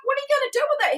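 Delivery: high, disbelieving question to her grandson; incredulous question. Retold or imitated. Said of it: "and I'm like".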